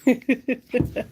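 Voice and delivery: string of short chuckles; light, rapid laugh. Real, not acted. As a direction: chuckle in quick bursts.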